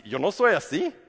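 a caught thief insisting to the judge; mock denial. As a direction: protest your innocence, half-joking.

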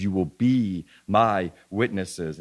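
"be" drawn out slowly; stress on "be". Shown as a demonstration. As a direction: stretch "be" so it stands out from the line. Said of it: "I emphasize the word be".

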